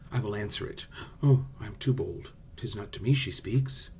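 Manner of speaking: natural, dramatic Romeo monologue with free rhythm; acted Shakespeare verse. Shown as a demonstration. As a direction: play the lovestruck speech with swelling questions and pauses. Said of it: "I was acting".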